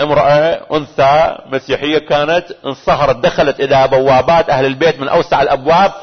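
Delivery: ordinary talk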